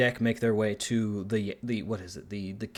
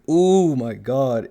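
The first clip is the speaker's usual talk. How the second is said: oh my God, drawn out slow and loud in disbelief; fed up, stretch it out loud; exasperated groan; 9 dB louder, much slower than usual. Real, not acted. Ranting about Disney reusing tropes, loud drawn-out exasperation.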